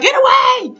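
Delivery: very high mock shout; Violet. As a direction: shriek it high, pushing him away. Imitating Violet rejecting Tate.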